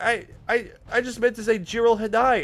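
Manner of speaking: high, loud, panicked stammer; scared squeak. Retold or imitated. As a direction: squeak it out terrified. Cornered nerd stammers.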